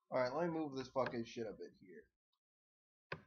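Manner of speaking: ordinary talk, nothing marked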